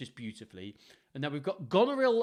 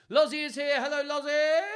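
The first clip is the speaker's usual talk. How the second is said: high, loud squealed hello; excited greeting; 13 semitones higher, 9 dB louder than usual. Real, not acted. Reader greets Lozzie arriving, high excited call. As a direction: call out a delighted welcome.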